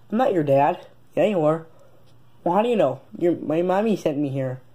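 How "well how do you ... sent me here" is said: ordinary delivery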